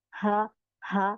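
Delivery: हा from the chest, slow, no breath on the hand; throat ha demo. Shown as a demonstration. Teacher showing students the throat हा sound. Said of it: "अगर आप अपना हाथ आप मुंह के सामने रखेंगी".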